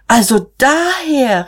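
drawn-out, high cry of realisation; exclaiming. A narrator's reading. Sister grasping where the money came from, exclaiming. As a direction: pitch soars as it suddenly adds up. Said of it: "rief Magda".

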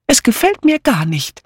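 high, emphatic objection; unusually energetic. A narrator's reading. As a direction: declare it with sudden energy. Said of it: "erklärte Dora mit ungewohnter Energie". (mit ungewohnter Energie)

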